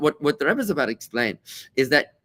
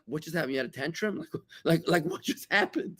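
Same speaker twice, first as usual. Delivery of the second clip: acted bewilderment, much softer voice; hushed, baffled reaction to a tantrum; stunned; 4 semitones higher, 7 dB quieter than usual. Listener reacting to a shouted tantrum, stunned.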